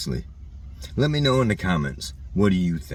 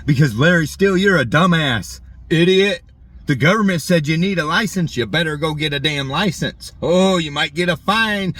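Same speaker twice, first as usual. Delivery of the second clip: higher and louder, a sneering mock scold; shrill mocking Karen; 6 semitones higher, 4 dB louder than usual. Ranting vlogger mocks a Karen scolding him about licenses, higher and louder. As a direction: mimic a self-righteous scold, pitched up and loud.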